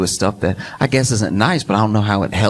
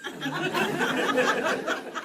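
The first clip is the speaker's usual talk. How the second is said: laughing; 9 dB quieter than usual. Real, not acted. Laughing mid-sentence.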